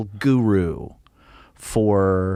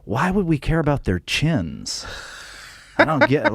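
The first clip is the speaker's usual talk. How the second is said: baffled, much higher American exec; confused executive; 7 semitones higher than usual. Retold or imitated. American label exec baffled after the meeting, pitched up. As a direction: play the puzzled record man. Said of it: "walked out of ... meeting go".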